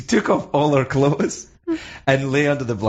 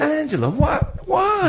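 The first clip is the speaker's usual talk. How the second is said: high-pitched scandalized wail; playful shocked outcry; mock dismay; slower than usual. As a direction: plead in hammed-up horror. Mock dismay at her nude scene.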